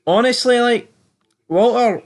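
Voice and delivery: high excited exclamation. Real, not acted. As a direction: blurt it out, worked up. Excited outburst about Walter.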